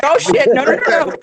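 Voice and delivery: high, hammed-up alarm; mock panic. Owner hearing the cat start to retch, acted high panic. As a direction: act out jolting awake in alarm.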